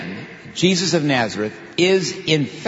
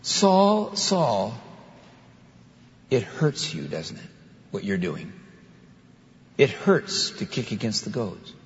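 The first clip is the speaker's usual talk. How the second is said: fading softer and softer, sorrowful; with pathos; 2 semitones lower, 4 dB quieter, slower than usual. Acted. Christ tells Saul the goads hurt him, quiet sorrowful pathos. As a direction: grieve over him quietly, almost under the breath. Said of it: "this interesting, uh, line of pathos".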